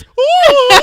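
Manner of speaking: very high, loud swooning ooh; mock delighted ooh. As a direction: tease her with an overjoyed coo. Imitates wife's delight at new man.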